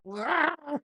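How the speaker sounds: very high burst of disbelieving laughter; high startled laugh on the second chug; shocked laugh. Retold or imitated. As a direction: laugh at realising it's bleach. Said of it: "And I was like".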